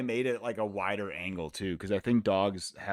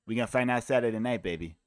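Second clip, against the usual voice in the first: Holloway impression; faster than usual. Streamer quoting Holloway's fight hype, lightly lisped. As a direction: quote the fighter hyping his fight, a touch of lisp. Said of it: "He's got a little bit of, like, a Nate Diaz, uh, lisp".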